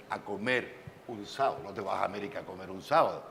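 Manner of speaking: ordinary talk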